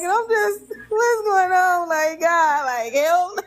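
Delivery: begging God through tears; tearful plea. Real, not acted. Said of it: "I was tripping".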